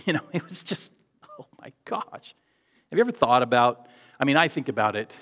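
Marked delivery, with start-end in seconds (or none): 0.0-2.3 breathless with awe, almost a whisper; awed hush. Real, not acted. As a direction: trail off into a near-whispered laugh. Awed aside after reading John.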